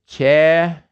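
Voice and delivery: Cheju with a short pure eh; Korean Cheju. Shown as a demonstration. Teacher asking students to read Cheju aloud. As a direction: say Cheju with a clipped eh. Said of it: "One of those eh vowels".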